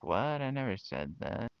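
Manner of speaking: lightly mocked mother, high and protesting; mocking mom. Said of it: "she's like".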